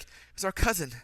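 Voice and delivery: hammed-up movie remorse; mock anguish. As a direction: overplay the regret a touch. Acting out Edmund's melodramatic film line.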